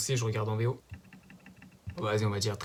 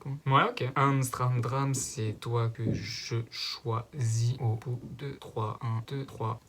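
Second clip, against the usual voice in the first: ordinary delivery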